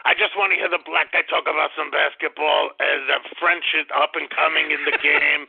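badly faked French accent, grumbling; put-on French accent. French caller dismisses the two Nazis, fake French-Austrian accent. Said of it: "his French impersonation sound much more like Arnold Schwarzenegger".

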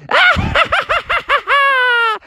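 high staccato evil laugh; villainous cackle. Acted. Python appears and laughs at Froggy.

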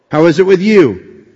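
ordinary delivery

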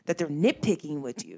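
ordinary talk, nothing marked

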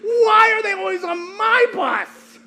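slow, high-pitched mock anguished shout; crying out. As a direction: wail it loudly to heaven, dragging the words. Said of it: "I just cried out to Jesus".

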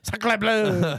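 mock French exclamation. Joking about French protests, hammed-up French accent.

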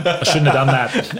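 laughing regret. Retold or imitated. Runner laughs recalling dread before the marathon. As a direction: laugh at your own mistake. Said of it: "And I go".

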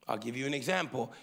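ordinary talk, nothing marked

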